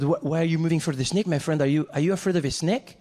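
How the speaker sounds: put-on incredulous French-accented questioning; French tourist accent, lifting into a question. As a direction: needle him in a thick French accent. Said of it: "the French guy turns to me".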